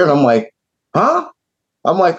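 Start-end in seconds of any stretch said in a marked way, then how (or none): 0.9-1.3 high, startled huh; confused by the denial, high and startled; baffled huh. Retold or imitated. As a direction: sound caught completely off guard.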